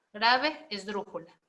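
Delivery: ordinary delivery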